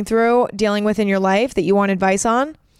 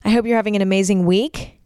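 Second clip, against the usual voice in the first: normal talking voice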